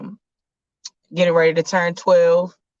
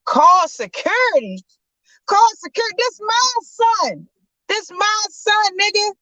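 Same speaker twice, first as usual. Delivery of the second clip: dad furious the doctor threatens security; outraged father. Acted. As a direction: take offence at the doctor's threat.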